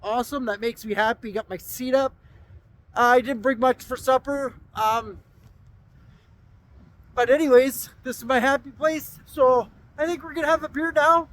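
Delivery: shouting over wind. Real, not acted. Still outside in gusting wind.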